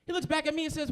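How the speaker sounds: ordinary talk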